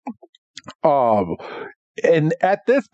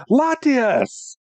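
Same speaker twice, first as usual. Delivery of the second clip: a high-pitched, urgent call of the name; 11 semitones higher than usual. Retold or imitated. Ash spotting the wounded Pokemon, a high urgent call. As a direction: cry the name out in alarm as he recognizes her. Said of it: "he's like".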